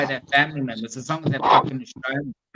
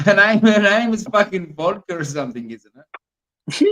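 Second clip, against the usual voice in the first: louder, higher, laughing through the words; laughing mockery; 5 semitones higher, 5 dB louder than usual. Real, not acted. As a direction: laugh as you mock her name. Mocking the woman's name.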